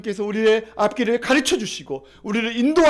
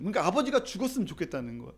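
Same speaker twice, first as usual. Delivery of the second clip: ordinary talk, nothing marked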